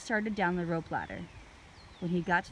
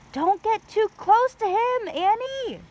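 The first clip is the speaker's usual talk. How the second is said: very high, very loud warning yell; warning shout; 11 dB louder, slower than usual. A narrator's reading. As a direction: shout to stop her getting close. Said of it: "Jack shouted".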